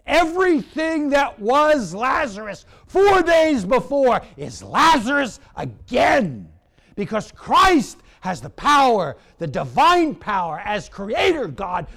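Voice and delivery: loud, high climax easing at the end; ringing pulpit shout; full-force preaching. Real, not acted. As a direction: proclaim it to the rafters, then ease off.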